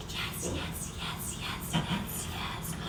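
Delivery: breathy whispered yes yes yes. Real, not acted. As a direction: whisper it in bliss. Delighted at first bite.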